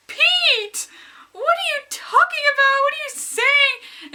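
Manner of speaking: high squealing laughing protest; laughing disbelief. Retold or imitated. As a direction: shriek it through giggles. Retold giggling reaction.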